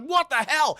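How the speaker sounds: high mock-startled outburst; acted shock. Guards reacting to Mister Cunningham attacking them, high startled outcry.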